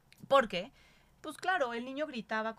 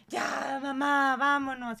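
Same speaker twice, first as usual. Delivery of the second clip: put-on a light, higher, louder toddler whine; 1 whiny toddler; 6 semitones higher, 7 dB louder, much slower than usual. Toddler pleading with his mother to leave, a light higher whine. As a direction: whine like a tired two-year-old begging to leave. Voices her toddler's tantrum.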